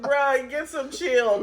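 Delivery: slow, exasperated "Bruh"; exasperated plea. Retold or imitated. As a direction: drag it out, fed up. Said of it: "I be like".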